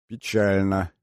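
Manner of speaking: mock sorrow. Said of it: "пригорюнился Лофтин".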